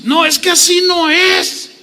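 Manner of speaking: very high imitated protest; insistent wife. Wife insists over her husband's answer, high-pitched acted protest. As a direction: burst in as an exasperated wife. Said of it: "Y ella se insiste".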